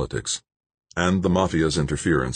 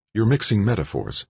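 plain reading voice

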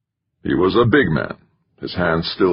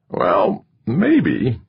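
higher, slow needling manager; wiry manager voice; 7 semitones higher than usual. A narrator's reading. Manager spelling out the loss to the candidate, higher and slow. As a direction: lay it out to him like a lecture. Narrator voicing McChesney.

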